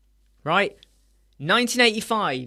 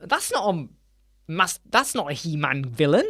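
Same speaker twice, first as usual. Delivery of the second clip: baffled fan. Retold or imitated. Fan spotting wrong villains in the movie, incredulous. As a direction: sputter in disbelief at the villains. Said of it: "You're like".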